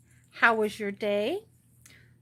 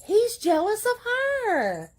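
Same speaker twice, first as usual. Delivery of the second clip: high gushing pet talk; 5 dB louder than usual. Real, not acted. Owner remarking on the jealous pet, high gushing squeal. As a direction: gush up high over a pet.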